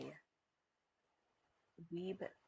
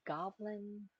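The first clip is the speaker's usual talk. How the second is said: goblin said higher, testing how it sounds; trying the word out; 5 semitones higher, 5 dB quieter than usual. Shown as a demonstration. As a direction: say goblin up high like you're trying it on. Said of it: "trying to think, goblin".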